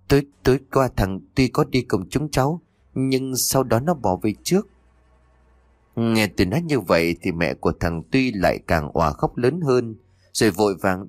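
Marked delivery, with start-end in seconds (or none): 0.1-4.7 put-on frightened boy stammering, a bit higher; stammering in fear. A narrator's reading. Boy answering his missing friend's mother, frightened stammer. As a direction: stumble over the first words, scared. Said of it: "Tôi lắp bắp đáp lại".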